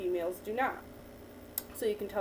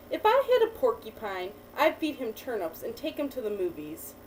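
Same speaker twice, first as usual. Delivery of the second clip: put-on reading to a child. Teacher reading a picture book to a child, lively storytelling. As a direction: read the picture book to the child, lifting each new animal.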